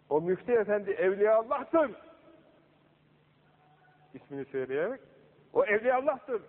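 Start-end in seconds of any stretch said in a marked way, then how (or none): 0.1-1.9 raised voice climbing high; retold shout. Saint rebuking a sleeper in his dream, shouting. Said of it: "bir bağırmış rüyada". 5.5-6.4 high, rising cry of rebuke; retold shout. Saint defending the mufti in a dream, shouting. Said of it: "diye bir bağırmış".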